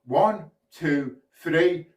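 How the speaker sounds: ordinary delivery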